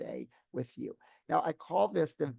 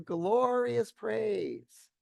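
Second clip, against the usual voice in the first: GLORIOUS PRAISE stretched out, high and loud; amplified glorious praise; 8 semitones higher, 6 dB louder, much slower than usual. Acted. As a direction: sing out "glorious praise" slowly, soaring in pitch.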